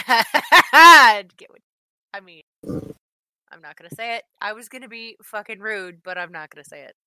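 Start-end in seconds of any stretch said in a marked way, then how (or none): 0.0-2.4 laughing. Real, not acted. Laughs mid-sentence.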